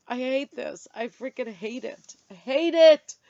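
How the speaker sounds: loud, high burst of real frustration; frustrated outburst. Real, not acted. Venting at the website, a loud frustrated outburst. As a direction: blurt out how much she hates it.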